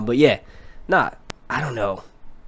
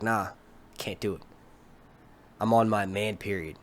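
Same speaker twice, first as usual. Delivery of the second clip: retold male refusal; 5 semitones lower, 8 dB quieter than usual. Retelling his refusal of her.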